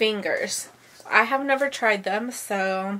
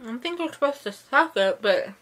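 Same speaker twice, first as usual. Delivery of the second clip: talking with a mouth full of chewy caramel. Real, not acted. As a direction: speak around a sticky sweet. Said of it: "Sorry for talking with my mouth full".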